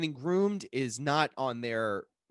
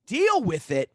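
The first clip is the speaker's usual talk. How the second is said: high, loud incredulous comeback; re-enacted outraged retort; acted indignation; 8 semitones higher, 7 dB louder than usual. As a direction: throw the words back at your sister. Retold quarrel with sister.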